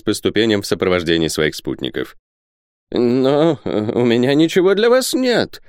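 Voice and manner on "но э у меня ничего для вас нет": put-on high, hesitant old man's whine; plaintive. A narrator's reading. Isaac telling visitors he has nothing, plaintive. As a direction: plead poverty in a rising wail. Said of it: "жалобно проговорил Исаак".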